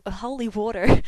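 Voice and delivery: "holy water" shouted, rising tune; loud holy water. Shown as a demonstration. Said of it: "pronounce the following words".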